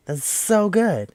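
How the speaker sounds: slow, high-pitched "so good"; gushing praise. Real, not acted. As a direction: push it up high and stretch it out. Second take, pitched up.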